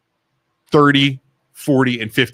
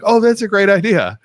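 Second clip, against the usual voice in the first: higher, mock-impressed agreement; mock enthusiasm; 7 semitones higher than usual. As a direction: imitate colleagues gushing over an idea. Mimicking agreeable colleagues in meeting.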